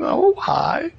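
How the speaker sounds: squeaky high falsetto greeting; nervous cheery hi, voice jumping way up; startled polite hi. Retold or imitated. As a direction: force out a high, strained friendly hello. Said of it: "he sees her as this old hag".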